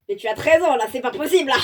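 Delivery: plus fort, outrée pour de rire; protestation forte. Real, not acted. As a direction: proteste comme devant l'absurde. Lectrice proteste contre la scène. (la lectrice proteste contre la scène, plus fort)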